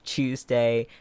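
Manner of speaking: Tuesday as "CHOOZ-day"; British pronunciation. Retold or imitated. Quoting how British people say it, Tuesday as "CHOOZ-day". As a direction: say Tuesday with a British ch sound at the start. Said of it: "I've heard British people say".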